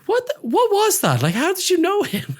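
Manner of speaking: very high mock bewilderment; astonished friend. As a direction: imitate a baffled friend, voice shooting up. Friend's retold amazement at the encounter.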